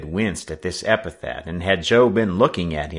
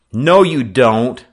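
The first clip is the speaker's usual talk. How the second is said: slow, loud, raised warning; forceful intervention; 5 semitones higher, 9 dB louder, slower than usual. Acted. Sailor stopping the skipper's second blow, forceful. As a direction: step in and stop him firmly.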